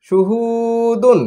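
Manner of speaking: very slow, long-held blend; drawn-out joined word. Shown as a demonstration. Teacher showing pupils how syllables join, very slow. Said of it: "যুক্ত করে করে উচ্চারণ".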